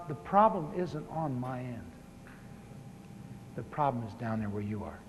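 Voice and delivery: soft, grave words from God; quiet voice of God. Retold or imitated. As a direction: hush it down like a gentle divine voice. God speaks to him.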